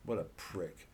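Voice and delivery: usual speaking voice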